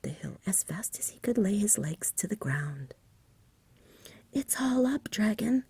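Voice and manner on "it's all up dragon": a shout kept in a whisper, pitched higher. Acted. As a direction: call out urgently but only in whisper. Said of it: "he shouted".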